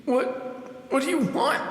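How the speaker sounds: high, shaky question; scared of the voice in the dark; fearful stammering plea; frightened stammer. Acted. Dying hero frightened.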